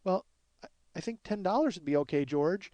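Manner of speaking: lightly voiced meek little lady; hesitant, modest request; little tiny lady. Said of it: "she's this little tiny lady".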